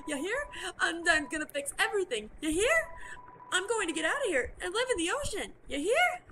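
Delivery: building plea. Acted. Onion-san's rising plea.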